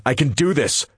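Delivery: insisting. Acted. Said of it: "I insist".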